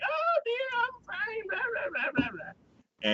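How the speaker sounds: very high put-on granny voice; squeaky hammed-up grandma impression; grandma's voice. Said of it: "tries to act like a grandma's voice".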